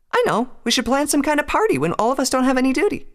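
very high, excited character voice; brightened up. A narrator's reading. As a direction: burst out with a happy idea. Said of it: "She brightened up for a moment".

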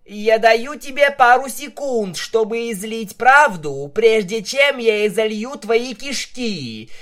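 loud, high furious roar; roaring threat. A narrator's reading. Vinyl threatening the captive with shears, loud furious roar. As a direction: roar the threat in his face. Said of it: "взревела Винил".

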